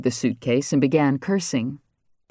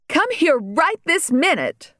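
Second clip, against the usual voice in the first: much higher, slow and harsh; harsh command; slower than usual. A narrator's reading. Regina scolds the mini horse that won't budge, high, slow and harsh. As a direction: bark it slowly, high and cutting. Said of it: "in a harsh tone".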